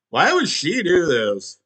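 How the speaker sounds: usual speaking voice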